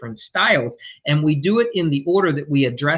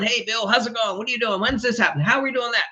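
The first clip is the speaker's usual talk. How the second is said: higher, eager rapid-fire questions; chatty influencer client; 5 semitones higher, faster than usual. Retold or imitated. Client pestering the contractor on the phone, lightly voiced chatty. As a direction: play the client who can't stop calling. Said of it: "wanna be on the phone".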